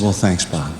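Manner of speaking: low, soft, worn-out father; quiet, pained. Retold or imitated. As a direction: let the words fall low and soft, hurting. Injured dad answering his son.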